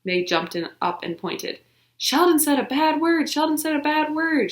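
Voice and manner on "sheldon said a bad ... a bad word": much higher, louder tattle; girl tattling loudly to the teacher. Acted. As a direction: sing it out to get him in trouble. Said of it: "she tattled real loud".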